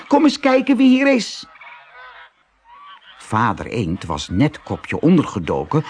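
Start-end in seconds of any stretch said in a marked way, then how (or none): 0.1-1.5 put-on high excited children's voices; excited call. A narrator's reading. Ducklings urging the parents to come look, high excited call. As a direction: urge them to come look, bright and high. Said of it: "riepen ze".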